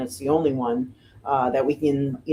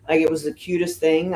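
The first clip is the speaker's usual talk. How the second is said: ordinary talk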